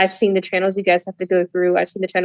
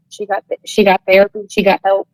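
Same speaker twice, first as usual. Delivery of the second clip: usual speaking voice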